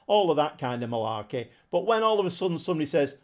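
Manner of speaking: usual speaking voice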